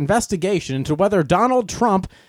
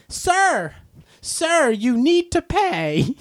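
much higher stern mock call, slow; imitating a call-out; 9 semitones higher, much slower than usual. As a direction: call the customer back like the worker. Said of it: "she's yelling at the person".

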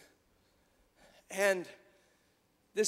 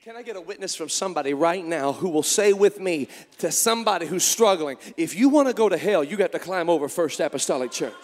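louder, building call to the crowd; fervent call for a witness; rousing preacher call; 8 dB louder than usual. Acted. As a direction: stir up the congregation for an answer. Calling for a witness.

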